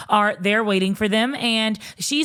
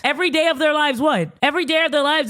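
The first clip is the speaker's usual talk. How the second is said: high, disbelieving repeat of the claim; incredulous echo; 6 semitones higher, faster than usual. Retold or imitated. Sister challenges what her brother's girlfriend claimed, incredulous. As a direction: throw it back in higher-pitched disbelief.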